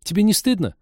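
put-on high, reproachful kid's question; childishly indignant. A narrator's reading. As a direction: scold like an offended child. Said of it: "поребячески возмутилась она".

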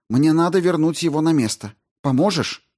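high, brisk woman astrobiologist; Рао объясняет. Acted. Narrator voices Rao asking help. (высоким голосом, деловито и встревоженно)

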